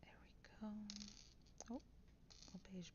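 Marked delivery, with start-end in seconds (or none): 0.0-0.7 whispering. Real, not acted. Quiet building whisper.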